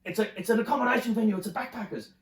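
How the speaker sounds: high, loud mock exasperated insistence; hammed-up angry boyfriend setting her straight; angry explanation. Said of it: "he got angry".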